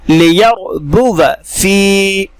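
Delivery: लियरबुव फी recited slowly without the alif; Quranic recitation. Shown as a demonstration. Teacher modelling the word, slow recitation. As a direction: chant लियरबुव फी. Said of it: "बगैर अलिफ के पढ़ेंगे".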